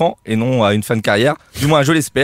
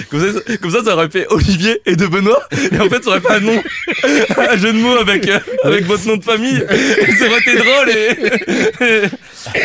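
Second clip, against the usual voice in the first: laughing, higher pitched; 7 semitones higher than usual. Real, not acted. Columnist cracking up at his own name joke. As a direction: chuckle through the pun explanation.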